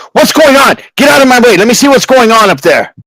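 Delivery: high, pushy guard captain; Captain Piltrop. Acted. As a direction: shout it high and impatient. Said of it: "He's like".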